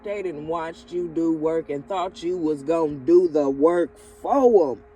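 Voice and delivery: rising loud rant. Real, not acted. Scolds the people who used the viewer, building to a shout. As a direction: build the outrage up to full volume.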